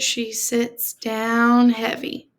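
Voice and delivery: normal talking voice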